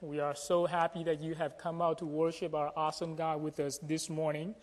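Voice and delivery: normal talking voice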